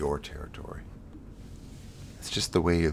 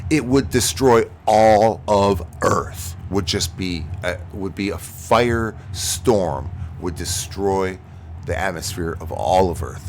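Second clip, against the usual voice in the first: dramatic doom; 3 semitones higher, 8 dB louder than usual. Acted. Streamer warning the Earth would be destroyed, booming slowly. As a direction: boom it out like a disaster trailer.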